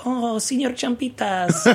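high, drawn-out fond greeting; sweet lady. Retold or imitated. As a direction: imitate a sweet manager cooing hello. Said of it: "a very sweet lady".

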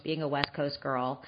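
ordinary talk, nothing marked

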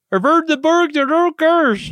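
a hint of hammed-up Swedish Chef accent; sing-song mock Swedish Chef accent for "Borgs". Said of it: "very Swedish chef way to put it".

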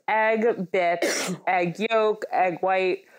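coughing between words; talking through a cough; coughing fit. Real, not acted. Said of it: "Sorry, I'm also coughing".

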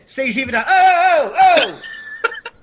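high, loud "Oh" cries after the word; New York yell. Retold or imitated. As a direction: holler it like an irritated JFK worker. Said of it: "They say it like this".

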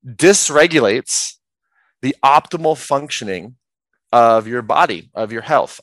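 slow and spaced-out, phrase by phrase; slow, deliberate definition. Real, not acted. Coach finishing a wordy definition slowly for listeners. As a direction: pause between each part, stretching key words. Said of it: "do my best to say it slowly".

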